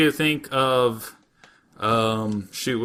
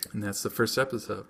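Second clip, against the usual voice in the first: ordinary talk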